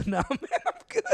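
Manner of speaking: laughing through the refusal. Retold or imitated. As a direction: giggle out the no thanks. Refusing coke while laughing.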